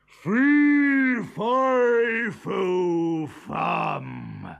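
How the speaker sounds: loud, falling giant chant; giant's roar. A narrator's reading. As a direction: roar it out word by word. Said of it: "he roared".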